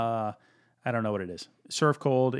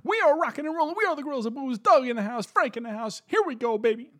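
much higher, loud hype-man announcer; 11 semitones higher, 3 dB louder than usual. Acted. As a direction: pump up the crowd like a sports announcer. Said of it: "Is that, like, a wrestling thing?".

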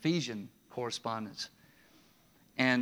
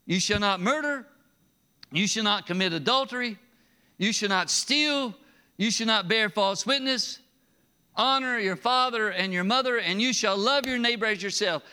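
loud, high, measured proclamation; proclaiming commandments; 6 semitones higher, 5 dB louder than usual. Acted. Preacher proclaiming the commandments to the congregation, loud. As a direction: declare each law firmly.

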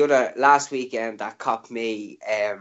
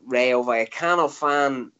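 ordinary talk

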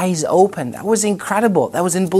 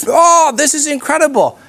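much higher, louder gasp of wonder; amazed; 11 semitones higher, 4 dB louder than usual. Retold or imitated. Man in the pub reacting to his healed headache, amazed. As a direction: let it burst out in astonishment.

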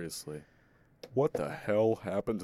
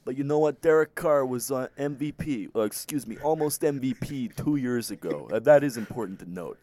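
higher, louder coach-commentator bluster; Gruden-style hype; 6 semitones higher, 6 dB louder than usual. Retold or imitated. Co-host defends Derek Carr in hammed-up coach bluster, louder and higher. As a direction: pump it up like a booming TV analyst. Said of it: "go full John Gruden".

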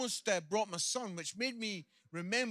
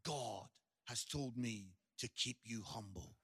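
lower, softer deadpan; deadpan calm; 9 semitones lower, 7 dB quieter than usual. Retold or imitated. Son calmly answering his dad, lower and soft deadpan. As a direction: calmly deliver the knockout line. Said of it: "my son looked at me and says".

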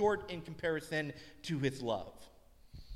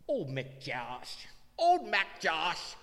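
put-on slow, childlike mispronounced sing-song, rising higher each time; imitating daughter; 4 semitones higher, 5 dB louder, much slower than usual. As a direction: mimic a little girl mangling the phrase. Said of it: "she doesn't say it quite right".

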